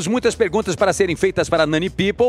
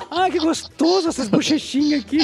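a lightly voiced higher mock sigh of pleasure; 7 semitones higher than usual. Joking about shaving, a light mock blissful voice. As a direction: savour it playfully. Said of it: "Eu ficava com a perna mexendo assim".

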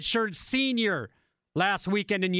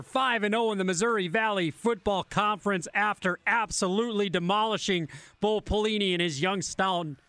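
usual speaking voice

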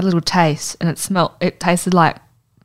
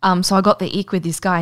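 normal talking voice

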